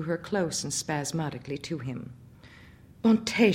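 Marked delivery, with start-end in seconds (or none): 3.0-3.5 high startled cry; frightened cry. Acted. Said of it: "she cried".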